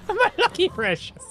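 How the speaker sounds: high, strained creature voice with Irish lilt; Irish Gollum. Pretend. Streamer keeps doing Irish Gollum for chat, high and greedy. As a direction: croon over your treasure in a brogue. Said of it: "say Gollum in an Irish accent".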